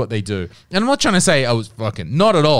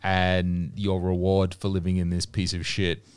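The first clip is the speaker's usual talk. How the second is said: low, quiet, deadpan local voice; mock Finnish local; 6 semitones lower, 6 dB quieter than usual. Host voicing Oulu locals about their cold hellhole, low and deadpan. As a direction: grumble it like a resigned northerner. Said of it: "that they're like".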